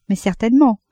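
plain reading voice

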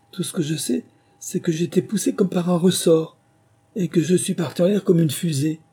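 high, thin little lizard voice; a shaken little lizard, piping; weak piping voice. A narrator's reading. The lizard telling his friends, in a thin high voice. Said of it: "une petite voix faible et flûtée".

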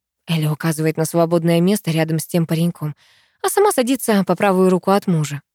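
ordinary delivery

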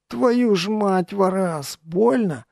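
high, drawn-out cry of pain; pained outcry. A narrator's reading. Wounded man to his friend lifting the helmet, crying out. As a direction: cry out in pain, stretching the words. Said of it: "Я вскрикнул".

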